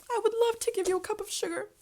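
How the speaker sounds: high, choked-up crying voice; tearful. Real, not acted. Imagining giving a neighbor sugar, real tears. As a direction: near tears, voice breaking. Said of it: "why am I crying about this".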